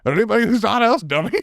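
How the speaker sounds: exasperated. Retold or imitated. Voicing Oswald exasperated.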